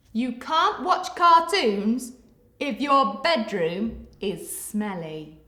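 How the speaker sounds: lively rhyming storybook read, loud at the start; reading to children. Acted. Teacher reading a picture book to children, lively. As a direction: read the rhyme aloud to little ones with bounce.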